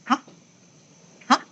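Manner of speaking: short rising "Hah?" of surprise; startled huh. Shown as a demonstration. Teacher showing students how a startled person sounds, surprised rising "Hah?". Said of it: "kalau orang kaget tuh gini".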